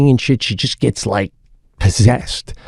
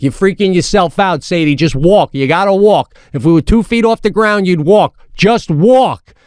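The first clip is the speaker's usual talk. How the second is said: insistent parent, pitched up; retold exasperated urging; 6 semitones higher than usual. Dad tells frightened daughter to keep moving, acted-out exasperation. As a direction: push the kid along, higher and more emphatic as you go.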